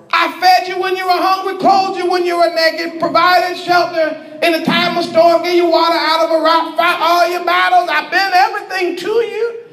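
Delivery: high, insistent rapid-fire list; aggrieved husband. Acted. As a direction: pile up everything you did for them. Yah lists all he did for wife.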